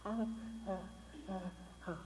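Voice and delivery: soft, lightly voiced ho ho of shame; a hint of ashamed crowd moaning; ashamed men lamenting. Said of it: "they do this".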